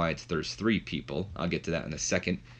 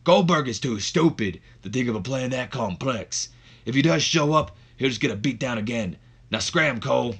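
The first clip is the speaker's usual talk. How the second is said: dismissive wrestler impression, pitched up; acted scornful wrestler, raised pitch; Austin; 4 semitones higher, 3 dB louder than usual. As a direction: brush it off in a put-on wrestler voice. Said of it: "Austin puts it off to the side saying".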